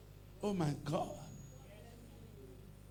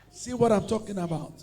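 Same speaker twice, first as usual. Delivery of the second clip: usual speaking voice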